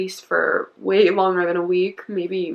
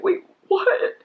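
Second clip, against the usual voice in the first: stunned disbelief; 11 semitones higher than usual. Retold or imitated. Turning to mom in shock.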